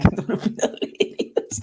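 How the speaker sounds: breathy laugh through the words. Real, not acted. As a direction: laugh it out, amused. Laughing at a funny idea.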